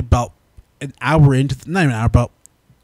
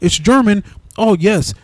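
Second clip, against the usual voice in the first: higher, gleeful mockery of the film; mock movie voice; 8 semitones higher than usual. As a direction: lightly voice the smug filmmakers. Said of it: "this movie was like".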